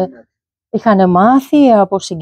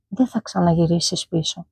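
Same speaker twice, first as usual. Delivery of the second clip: a soft voice heard inside the head; quiet inner voice; 3 semitones lower, 8 dB quieter than usual. Retold or imitated. He recalls the inner voice that warned him, softly. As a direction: say it low, like a voice within. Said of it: "άκουσα μέσα μου μια φωνή".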